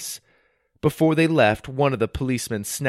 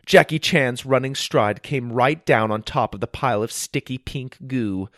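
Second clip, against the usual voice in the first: usual speaking voice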